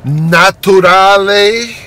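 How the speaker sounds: mock French word. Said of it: "like my French friends say".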